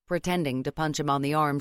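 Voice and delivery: plain reading voice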